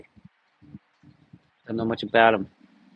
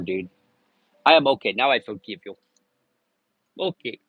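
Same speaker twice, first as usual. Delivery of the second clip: ordinary talk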